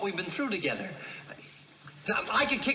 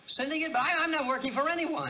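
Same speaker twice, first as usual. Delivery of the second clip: high, startled protest; shocked disbelief; 8 semitones higher than usual. Acted. Suspect startled by accusation.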